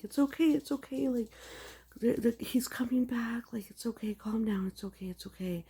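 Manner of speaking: high, hushed pleading reassurance; desperate soothing. Retold or imitated. Dreamer hushing the mourning cows, high desperate soothing. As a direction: coo it like calming a frightened animal. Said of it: "we're trying so desperately to".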